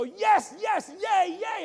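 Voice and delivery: put-on boy jumping up, shouting for joy; excited boy cheering. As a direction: cheer like a thrilled little boy. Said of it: "began to jump up again and go".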